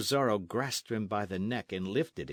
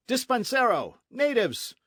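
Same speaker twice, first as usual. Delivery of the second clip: loud, much higher cry for help; shouting for help; 13 semitones higher, 7 dB louder than usual. Acted. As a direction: yell to the servants to seize the bandit. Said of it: "the captain shouted suddenly".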